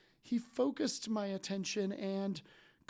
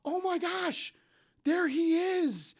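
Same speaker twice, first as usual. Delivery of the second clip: much higher and louder, starstruck gush; gushing fan; 9 semitones higher, 5 dB louder than usual. Retold or imitated. Stranger at the table gushing over the speaker on stage, high starstruck squeal. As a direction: squeal like you've spotted your idol. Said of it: "She's like".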